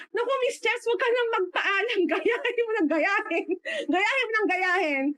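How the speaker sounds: mataas na masayang boses ni Sheila; masiglang pagpayag. Retold or imitated. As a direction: mataas na tinig, medyo mabilis at may tawa. (masiglang sagot, mataas ang tono)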